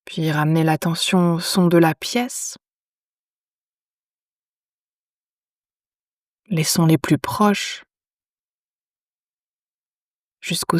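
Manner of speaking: calm yoga nidra guidance with long pauses; unhurried, measured phrases, leaving silence after each instruction; guided meditation. Acted. Teacher guiding lying listeners into yoga nidra, calm meditation voice.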